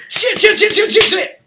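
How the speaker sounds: high, loud imitation of a panicked teacher; panicked yelping. Acting out a teacher whose robe caught fire, panicked yelping.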